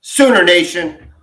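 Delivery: drawn-out hype call to the fans; slow, loud announcer welcome; podcast intro call-out. Acted. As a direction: belt it out like a show opener.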